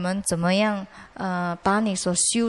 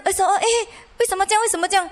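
high-pitched startled questioning; higher, alarmed retelling; 9 semitones higher, much faster than usual. Retold or imitated. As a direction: re-enact a sudden "what's happening?" panic. Acting out alarm as car spins.

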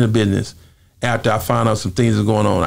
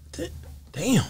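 baffled; 8 dB quieter than usual. Retold or imitated. Retold baffled reaction.

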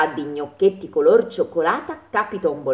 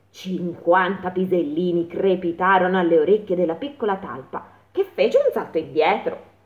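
ordinary delivery

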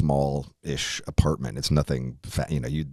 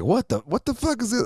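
high, startled outburst; shocked. Retold or imitated. Drunk friend finds Cheez Whiz in his hat, startled. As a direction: discover the goo in your hair.

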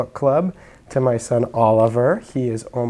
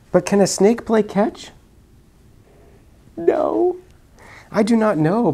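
character voices; 10 semitones higher, 4 dB louder than usual. Acted.

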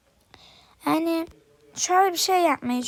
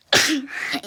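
sneezing again; quick real sneeze; 4 dB louder than usual. Real, not acted. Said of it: "Şimdi üst üste hapşuracağım". (hapşırma)

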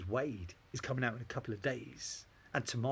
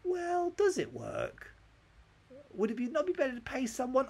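very high-pitched questioning voice; squeaky pretend objection; acted doubter; 13 semitones higher than usual. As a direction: query it in a mock falsetto. Acted doubter questions his plan.

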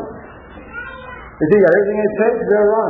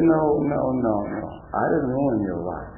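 calm denial; 6 semitones lower, 8 dB quieter than usual. Retold or imitated. Dance master calmly denies blame.

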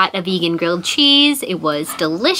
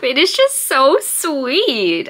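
slow, choked-up words, close to tears; holding back tears over a sweet book; tearing up; 5 semitones higher, much slower than usual. Real, not acted. Vlogger moved to tears by her book, choked up. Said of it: "I'm, like, tearing up".

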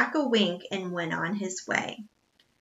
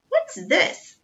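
surprised; 10 semitones higher, 3 dB louder than usual. A narrator's reading. Butler finds pig in porthole.

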